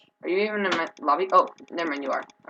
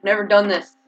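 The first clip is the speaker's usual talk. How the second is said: ordinary talk, nothing marked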